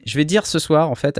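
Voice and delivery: usual speaking voice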